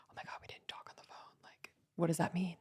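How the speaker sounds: hushed, whispered quote, hurried at first; very soft acted whisper; mock whispered worry. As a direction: whisper it as an imagined fretful thought. Mocking a codependent friend's worry.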